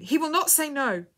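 loud, high assurance; urging. A narrator's reading. Woman encouraging the dying girl, urgent and insistent. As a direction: insist with conviction.

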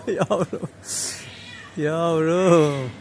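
cooing. Real, not acted. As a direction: coo it up high. Cooing to the kitten.

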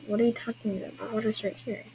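usual speaking voice

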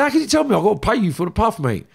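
high, indignant re-enacted challenge; mock-screamed complaint, toned down; retold irate protest. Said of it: "screaming at him when I'm talking".

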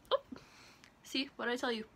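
usual speaking voice

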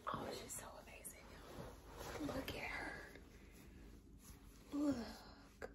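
soft, fast whisper with a breathy laugh; hushed cooing whisper; adoring whisper. Real, not acted. Cooing over the doll.

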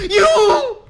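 real jump scare yell; startled scream. Real, not acted. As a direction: gasp then a high, loud shriek of fright.

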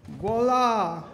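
high, loud showman's voila; a proud little fanfare of a word; triumphant voila. Real, not acted. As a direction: announce it like a magic trick. Result appears, triumphant exclamation.

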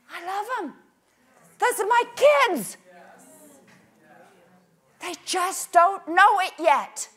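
high, loud impassioned cry; preacher's loud rising shout; fervent proclamation. Retold or imitated. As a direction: proclaim it with fierce love. Jesus proclaiming love loudly.

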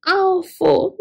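high plaintive wail of the word; meowing cat. Acted. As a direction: meow it out like a sad cat. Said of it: "meowed Cat".

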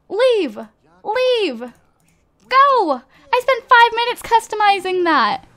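high, loud exasperated outburst; indignant at losing her work; real frustration. Real, not acted. Gamer yelling at the game over her lost build. Furious at repossessed custom item.

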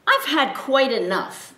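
mean owner at the end of his patience; fed up owner. Acted. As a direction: snap it out, done with him.

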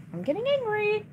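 "angry" shot up high in exasperation; same words, now a high frustrated whine; exasperated yelp. Real, not acted.

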